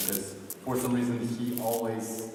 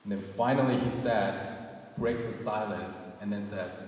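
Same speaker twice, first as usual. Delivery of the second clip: ordinary talk, nothing marked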